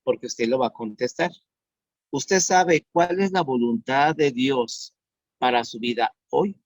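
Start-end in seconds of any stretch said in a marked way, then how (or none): 2.1-6.6 slow repeated question. Shown as a demonstration. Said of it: "Escuche bien".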